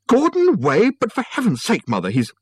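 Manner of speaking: very high, loud incredulous outburst; appalled disbelief. A narrator's reading. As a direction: shriek it in shocked protest. Son appalled at the buyer's name.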